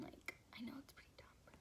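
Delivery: whispered confession to the viewers; sheepish whisper. Real, not acted. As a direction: whisper it as if a little embarrassed. Self-conscious aside to viewers.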